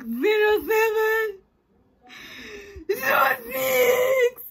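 crying shout. Retold or imitated. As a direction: sob out the numbers in a meltdown. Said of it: "having a full-on meltdown".

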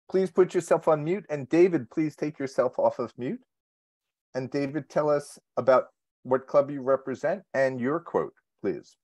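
ordinary talk, nothing marked

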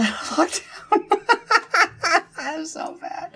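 laughing. Real, not acted. Laughing after dying.

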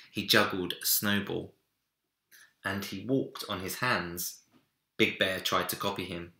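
plain reading voice

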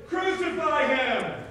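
slow, loud, high-pitched shout; crowd shouting. Acted. Crowd answering Pilate about Jesus, shouting. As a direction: shout it out like a mob, each word drawn out. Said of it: "And the crowd shouted".

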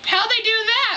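very high, incredulous mom voice; high-pitched mock disbelief; imitated mom. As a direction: mimic mom in a high squeal. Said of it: "And Mom's like".

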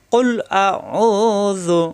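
very slow drawn tilawat; Quran recitation. Acted. Teacher chanting the words for students.

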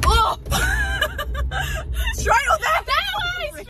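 high, loud panicked squeal; panicking, unsure where to turn; panicked driving. Real, not acted. Panicking at which way to drive.

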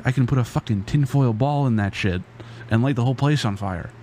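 normal talking voice

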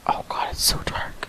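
frightened, tearful whisper; scared. Real, not acted. Player alone in the game's dark, scared whisper. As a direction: whisper it as if close to whimpering. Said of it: "I'm scared".

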